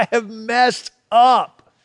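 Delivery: slow, high-pitched acted lament; acted cry of regret, slow and high; hard cry. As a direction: draw out each word in mock anguish. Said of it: "It's a hard cry".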